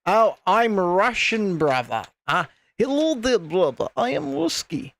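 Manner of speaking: put-on, hammed-up Russian accent; terrible Russian accent. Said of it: "terrible Russian accent".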